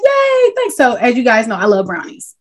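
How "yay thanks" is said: high, chirpy little thank-you; mock cheerful yay. Retelling the brownie gift to podcast co-hosts, mock cheerful. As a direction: play it overly eager and naive. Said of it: "And I'm like".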